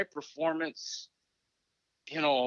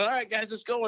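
retold pep call, pitched up; rally the team, a touch excited; jacked up; 7 semitones higher than usual. Coach rallying the guys in the locker room, jacked up. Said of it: "I'm all jacked up going".

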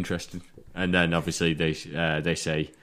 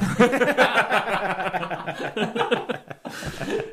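laughing high and giddy. Real, not acted. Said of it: "That was awesome".

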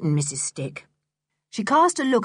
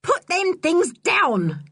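high, slow, sharp order; 11 semitones higher, much slower than usual. A narrator's reading. Woman ordering boy to put supper back, sharp. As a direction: order him sharply, stretching each word. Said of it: "said Missus Stick sharply".

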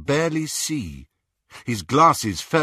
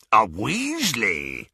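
rasping; slower than usual. Acted. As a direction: rasp it out with interest. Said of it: "rasped Greyback".

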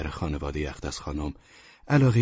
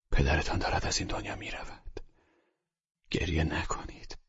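doctor soothing a tearful daughter in a whisper; 6 dB quieter than usual. Acted. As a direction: whisper gently at the deathbed. Telling her father is dying.

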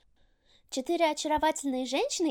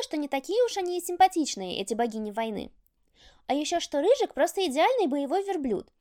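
plain reading voice

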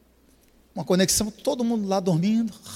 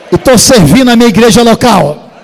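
much louder proclamation; testimony build; 4 semitones higher, 16 dB louder than usual. Real, not acted. Preacher relaying the woman's testimony, louder. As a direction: proclaim it like a victory.

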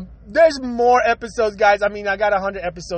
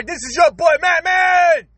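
higher and louder, calling out his name; hyped sign-off shout; 7 semitones higher, 4 dB louder, slower than usual. Real, not acted. Host signs off to his listeners, hyped shout. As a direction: announce yourself like a hype host.

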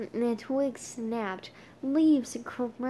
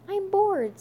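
high whiny complaint; whiny bored; 9 semitones higher, 7 dB louder than usual. Acted. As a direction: whine it like a kid.

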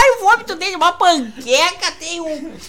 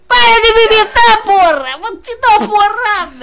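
angry yell; 6 semitones higher, 3 dB louder than usual. Acted. Angry puppet yells at drunk Tommy.

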